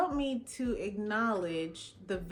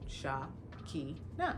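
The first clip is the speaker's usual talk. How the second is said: "Sha, Ki, Na" pulled into three separate beats, softer; syllables split apart; 6 semitones lower, 6 dB quieter than usual. Retold or imitated. Teacher breaking the student's name into syllables, each said apart. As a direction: count out "Sha, Ki, Na" one syllable at a time. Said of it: "This first name has three syllables".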